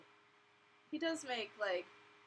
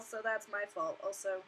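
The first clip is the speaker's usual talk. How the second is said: normal talking voice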